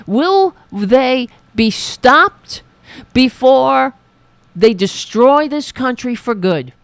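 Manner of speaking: loud, slow, furious outburst; slow, heavy-handed rant, each word hammered; angry shout. Real, not acted. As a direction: bellow the question at the country. Ranting against political opponents.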